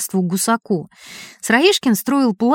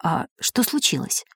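very soft, shy question; timid question; 8 dB quieter than usual. A narrator's reading. Seryozha timidly asking a calm stranger, very soft. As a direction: ask it timidly, barely aloud. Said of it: "робко спросило".

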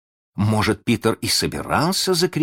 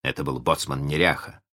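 usual speaking voice

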